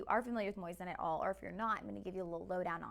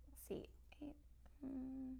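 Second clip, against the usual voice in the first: whispered to self; 4 semitones higher, 14 dB quieter, slower than usual. Real, not acted. Checking a figure under breath.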